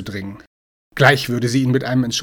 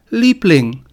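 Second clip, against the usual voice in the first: higher, louder appeal to a lover; appealing call; 6 semitones higher, 7 dB louder than usual. A narrator's reading. Man calls to girlfriend pushing him out, appealing. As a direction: call out to win them back. Last try to persuade his girlfriend.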